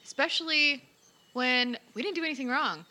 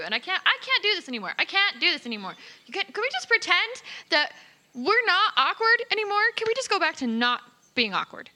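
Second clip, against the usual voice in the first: high, louder, exasperated plea; exasperated pleading; 7 semitones higher, 5 dB louder than usual. Acted. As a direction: plead as if at your wit's end. Acted plea to end awkwardness.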